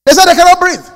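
high, loud disbelief; incredulous. Real, not acted. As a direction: say it like you can't believe it. Incredulous repeat of claim.

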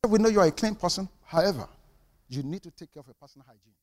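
soft, quick, gentle telling-off. Retold or imitated. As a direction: break it to him kindly and quietly. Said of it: "it was very gentle".

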